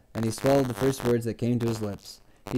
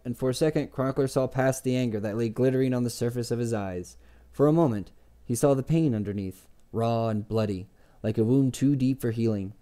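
usual speaking voice

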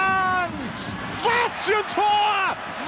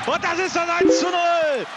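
excited goal call; 5 semitones higher, 4 dB louder than usual. Real, not acted. Goal scored in live commentary.

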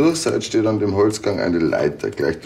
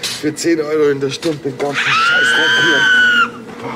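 fed-up angry shout; 5 semitones higher, 5 dB louder than usual. Acted. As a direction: rant and shout at everyone. Angry outburst at the nuns.

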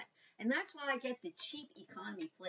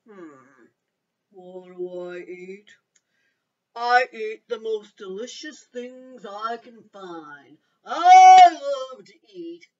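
slow, looming crocodile menace; alligator voice; 25 dB louder, much slower than usual. A narrator's reading. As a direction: drag it out like a hungry alligator. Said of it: "And the alligator said".

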